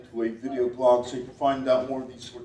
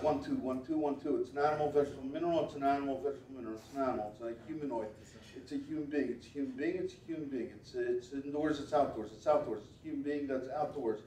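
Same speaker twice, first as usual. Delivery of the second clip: mind-reading patter; 10 dB quieter than usual. Real, not acted. Mentalist murmuring guesses at what someone drew, soft and repetitive. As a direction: run through guesses quietly, like an incantation.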